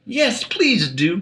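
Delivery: high, slow pleading; a slow high plea. A narrator's reading. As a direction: beg sweetly. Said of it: "pleaded Alice".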